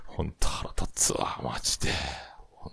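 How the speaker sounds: whispered grumble. Real, not acted. Whispering his annoyance at his podcast friend's popularity.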